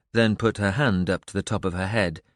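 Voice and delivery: usual speaking voice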